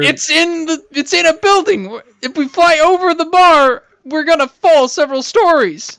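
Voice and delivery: high-pitched, worked-up objection; urgent high-pitched warning; alarmed protest. Acted. Alarmed objection to the flying plan.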